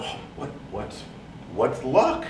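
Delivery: breathy hush, then back up on luck; a hushed aside of false delight; mock awed whisper. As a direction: whisper it like a lucky secret.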